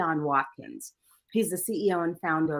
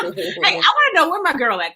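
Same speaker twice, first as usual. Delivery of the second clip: higher and louder, calling out; excited call-out; 11 semitones higher, 7 dB louder than usual. Real, not acted. Excited call-out to Angie on the livestream. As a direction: hype up your friend. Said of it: "I have to shout out North Philly".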